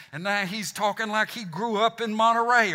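usual speaking voice

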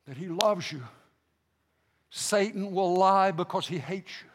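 ordinary delivery

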